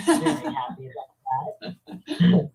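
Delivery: real laugh through the words. Real, not acted. Guest tells of burning a freed prisoner's envelope, laughing.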